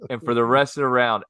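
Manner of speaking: ordinary delivery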